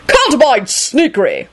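put-on high, indignant old man's bellow. A narrator's reading. Richard complains of sneaking, bellowing. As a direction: bellow it in cross disapproval. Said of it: "Richard bellowed".

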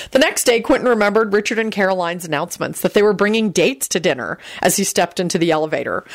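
plain reading voice

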